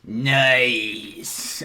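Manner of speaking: long, loud drawn-out approval; Gamzee. Acted. Clown preacher approves the apology, loud drawn-out character voice. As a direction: stretch it out like a delighted cult preacher. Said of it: "I fucking love doing Gamzee's voice".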